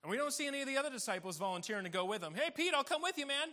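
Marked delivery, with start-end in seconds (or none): 2.3-3.5 loud, high pretend offer to tag along; volunteer with exaggerated eagerness; mock eager volunteer. Disciple offering to join Peter, mock eager.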